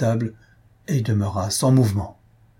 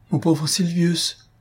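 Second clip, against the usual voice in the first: higher, slow, tearful; wife sobbing over her doomed husband, high and slow; 8 semitones higher, slower than usual. A narrator's reading. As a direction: break down in tears over his name. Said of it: "répondit Albina en sanglotant".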